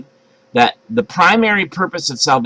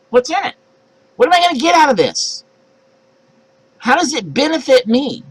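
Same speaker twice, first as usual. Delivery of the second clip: high, petulant voice haggling with God; whiny bargaining believer; 7 semitones higher than usual. Acted. Believer whining to God about what's in it, high and petulant. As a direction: demand a reward like a spoiled child. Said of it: "our obedience ... is conditional".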